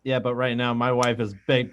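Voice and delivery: ordinary talk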